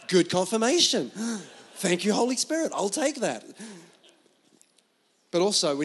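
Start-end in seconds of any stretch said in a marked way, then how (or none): 0.1-3.4 amused relief. Retold or imitated. Relives relief at dad's approval.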